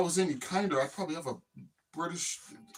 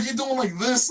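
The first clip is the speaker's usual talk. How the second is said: ordinary delivery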